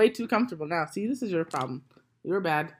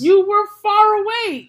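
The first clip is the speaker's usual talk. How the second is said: loud, slow, much higher yell; 13 semitones higher, 14 dB louder, slower than usual. Real, not acted. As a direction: shout the complaint. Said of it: "Stop yelling".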